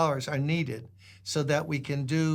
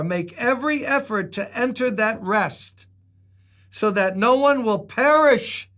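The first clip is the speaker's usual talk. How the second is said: louder, rising preacher's call; full-force preaching; 2 semitones higher, 5 dB louder than usual. Acted. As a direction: urge the whole church to enter God's rest.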